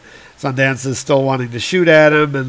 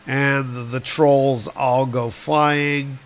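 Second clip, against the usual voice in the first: usual speaking voice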